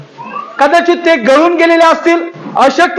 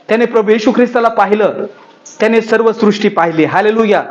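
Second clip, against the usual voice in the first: lower, softer preacher voice telling the miracle; hushed sermon storytelling; 8 semitones lower, 3 dB quieter, faster than usual. Acted. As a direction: draw them in quietly with the healing story. Quiet lower retelling of miracle. (हळू, खालच्या आवाजात उपदेशकाची कथा)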